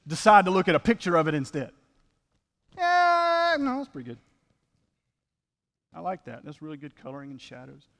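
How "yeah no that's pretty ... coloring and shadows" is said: mock admirer. Voicing someone admiring a picture.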